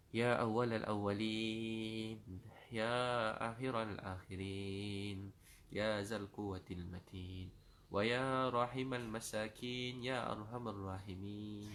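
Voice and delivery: slow, drawn-out Arabic invocation; chanted opening of a supplication; prayer invocation. Real, not acted. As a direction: intone the divine names slowly, stretching each ending.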